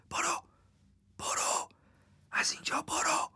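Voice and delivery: unvoiced, spaced-out whisper; urgent whisper. Crow on the bonnet urging the narrator away, whispering. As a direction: whisper it as a secret warning to flee.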